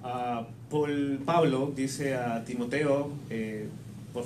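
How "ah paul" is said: normal talking voice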